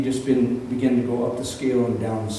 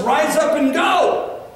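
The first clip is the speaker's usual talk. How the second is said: high, slow shouted command; voice of God commanding; 7 dB louder, much slower than usual. Acted. Pastor voicing God to his church, shouted command. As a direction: call them to rise like God himself. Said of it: "God says to us".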